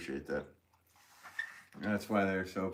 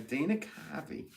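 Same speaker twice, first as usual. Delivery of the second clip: Dana Carvey; 6 semitones higher, 4 dB quieter than usual. Acted. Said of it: "I've never tried to do".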